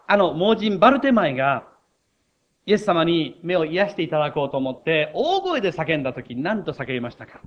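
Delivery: ordinary talk, nothing marked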